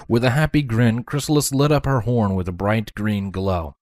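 ordinary delivery, nothing marked

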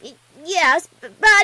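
loud, stammering little filly; stuttering. A narrator's reading. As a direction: trip over the first sound of each word. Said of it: "Twilight stuttered".

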